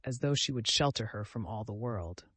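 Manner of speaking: usual speaking voice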